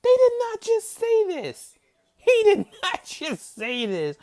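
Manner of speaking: real outraged shriek; shrieking disbelief. Real, not acted. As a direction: scream your disbelief.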